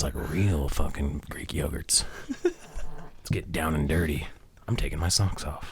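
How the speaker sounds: slow, breathy mock-intimate whisper. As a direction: lean in and whisper it slowly. Jokey intimate interrogation setup.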